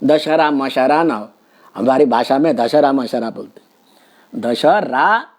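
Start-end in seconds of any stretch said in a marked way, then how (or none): none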